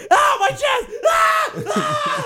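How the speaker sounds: very high, loud hammed-up screams of pain; mock chest pain cries. Friend mimicking a buddy's panic attack, hammed-up screaming. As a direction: wail like you're having a heart attack, overdone. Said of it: "it was just like".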